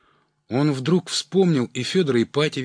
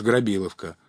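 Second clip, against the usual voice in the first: ordinary delivery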